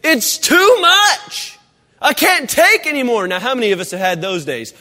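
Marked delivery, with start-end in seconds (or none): 0.0-3.3 mock overwhelmed cry. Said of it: "he says".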